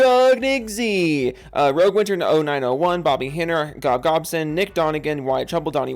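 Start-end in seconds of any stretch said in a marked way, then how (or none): 0.1-1.4 high, loud, long drawn-out shout; hammed-up name call. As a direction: stretch the name into a big playful holler. Hams up a member name.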